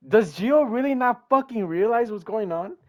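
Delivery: loud, high frustrated outburst; fed-up loud rant; exasperated yell. Real, not acted. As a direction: yell it at the screen. Frustrated at his character.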